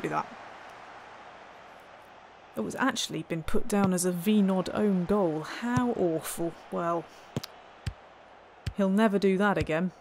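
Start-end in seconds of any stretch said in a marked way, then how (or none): none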